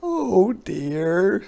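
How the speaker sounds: a sighed, weary oh dear; sighing. A narrator's reading. Hedgehog still covered in things, sighing. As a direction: sigh it out in defeat. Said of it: "sighed Hedgehog".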